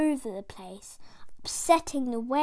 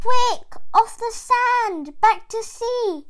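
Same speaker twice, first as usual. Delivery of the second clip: much higher and louder, urgent snail; snail cries out; 9 semitones higher, 9 dB louder than usual. Acted. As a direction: shout the alarm to the stranded whale. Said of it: "cried the snail".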